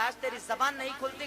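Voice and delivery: ordinary delivery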